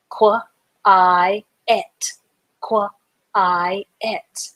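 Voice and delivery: "Qu-, I, et." split into three separate sound chunks with gaps; spelling-lesson syllable sounding. Shown as a demonstration. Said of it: "Q W has the qu sound".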